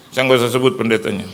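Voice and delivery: normal talking voice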